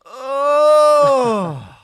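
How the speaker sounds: long, very loud, high mock-ecstasy cry; hammed-up wail of rapture; ecstatic moan. Sending up Malfoy's ecstasy with a long loud high cry. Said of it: "said Malfoy in ecstasy".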